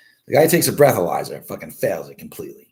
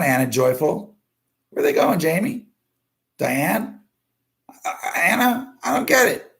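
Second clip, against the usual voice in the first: calling out; 7 semitones higher than usual. Real, not acted. Host calls out to viewers leaving the stream, baffled. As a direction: plead with the leaving crowd, pitched up.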